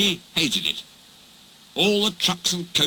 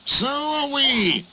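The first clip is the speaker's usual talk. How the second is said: hissing; 12 semitones higher, slower than usual. Acted. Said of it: "hissed the engines".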